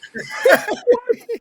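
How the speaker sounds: high, loud burst of laughter; big laugh. Real, not acted. Host laughs hard after impression, real laughter. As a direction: crack up at your own bit.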